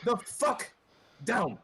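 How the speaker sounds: high, loud, furious yell; screaming at them. Acted. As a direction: scream at the whole group. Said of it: "and goes".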